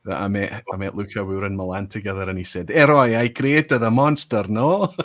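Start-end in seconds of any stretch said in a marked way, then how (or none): 2.7-4.9 put-on loud, high-pitched Italian accent; Luca's Italian accent.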